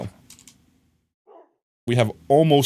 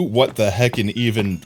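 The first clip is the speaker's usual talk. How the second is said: ordinary talk, nothing marked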